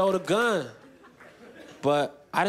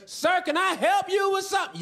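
high-pitched Southern country nurse; mock a Southern nurse, pitched way up; thick Southern country accent; 13 semitones higher than usual. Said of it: "thick Southern country accent".

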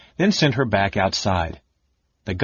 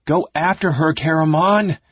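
higher, louder urgent cry; cried out; 7 semitones higher, 4 dB louder than usual. Acted. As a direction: push him out the door with it. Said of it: "she cried".

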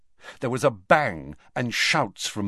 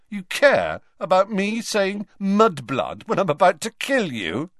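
put-on much higher, louder scornful boy; scornful Malfoy; 11 semitones higher, 5 dB louder than usual. A narrator's reading. Malfoy mocking the headmaster, high and scornful. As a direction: sneer the question up at him. Malfoy sneers, harsh laugh.

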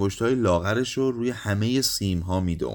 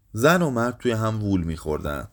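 ordinary delivery, nothing marked

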